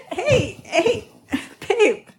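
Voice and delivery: laughing loudly through the words. Real, not acted. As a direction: giggle it out, calling to them. Laughing while calling partner.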